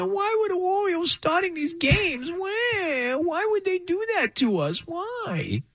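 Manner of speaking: high crybaby whine; whiny baby voice. Retold or imitated. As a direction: plead like a tearful toddler. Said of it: "that's how they sound".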